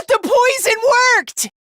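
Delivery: high, exclaimed triumph; excited exclamation. Acted. Seeing the poison worked, high excited cry.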